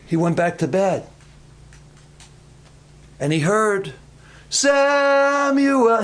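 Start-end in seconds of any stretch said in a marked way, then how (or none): 4.5-6.0 high, long-drawn call. Acted. God calls to sleeping Samuel in the night, long high call. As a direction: stretch the name out on a high, held note. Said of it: "He knew that voice now".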